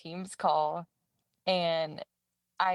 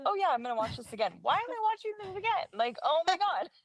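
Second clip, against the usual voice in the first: exasperated self-mockery; 11 semitones higher, faster than usual. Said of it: "and I was just like".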